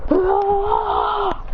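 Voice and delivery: high roar of effort; power-up yell. Acted. Powering up, punching back blast.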